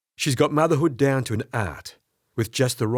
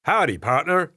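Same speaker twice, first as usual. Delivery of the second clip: bad American accent; 5 semitones higher than usual. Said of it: "I answer in my best American".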